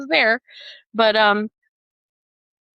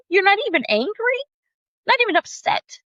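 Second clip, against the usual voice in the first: higher, disbelieving protest; can't believe he isn't mad; mock outraged disbelief; incredulous; 11 semitones higher than usual. Retold outrage at God's kindness.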